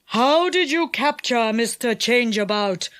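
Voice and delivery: lightly voiced enchanter asking; enchanter's voice. A narrator's reading. Enchanter asks how Changeabout was captured, in character. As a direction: question him in a villain's voice. Enchanter questions Moonface, shaken.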